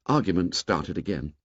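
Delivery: ordinary delivery, nothing marked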